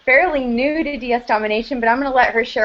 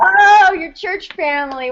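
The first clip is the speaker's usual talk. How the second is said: bright amused "oh", pitched well up and louder; surprised; 7 semitones higher, 5 dB louder than usual. Real, not acted. Host surprised by guest's church family answer, delighted.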